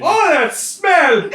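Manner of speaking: high, loud, drawn-out wail of disgust; disgusted outcry. Acted. Charging elf hits the stink in the room, disgusted wail. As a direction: cry out, slow and revolted.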